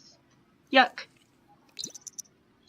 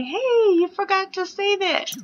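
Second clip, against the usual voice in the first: viewer comment voice; 9 semitones higher than usual. Retold or imitated. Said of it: "comment below and say".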